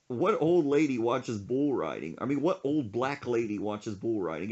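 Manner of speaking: usual speaking voice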